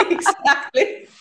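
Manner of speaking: high, loud laughing words. Real, not acted. Guest laughing while telling the crying story.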